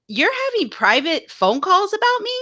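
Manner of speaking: high-pitched incredulous question; retold disbelief. Host replays her reaction to being trashed, incredulous.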